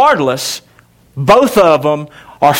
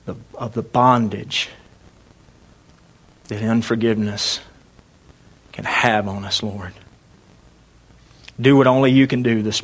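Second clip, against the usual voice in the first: closing prayer; 7 semitones lower, 5 dB quieter than usual. Real, not acted. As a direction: pray quietly with long pauses. Pastor prays after reading.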